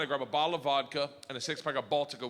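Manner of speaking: ordinary delivery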